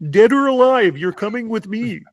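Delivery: high nasal-free puppet frog voice, RoboCop line; Kermit the Frog voice. Retold or imitated. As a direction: Kermit doing a RoboCop threat. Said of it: "practicing my Kermit the Frog voice".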